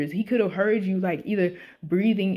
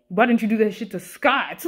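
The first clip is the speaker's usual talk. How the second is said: usual speaking voice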